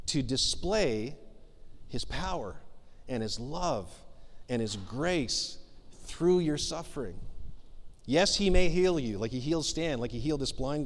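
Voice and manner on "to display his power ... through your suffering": tender. Acted. Tender sermon close.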